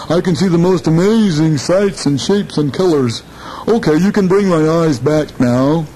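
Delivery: a big cat squealing with delight at the sights; amazed jaguar. Acted. Jaguar amazed by what he sees.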